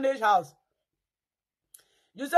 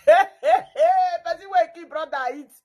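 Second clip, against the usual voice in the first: usual speaking voice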